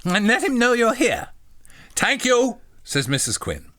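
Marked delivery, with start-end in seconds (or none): 0.0-1.3 high, simple-minded little voice; eager, high-pitched polite question; childlike John. Acted. Voicing John in the story. 2.0-2.6 high, loud mock Irish accent; badly faked Irish lady. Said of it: "you can't do an Irish accent".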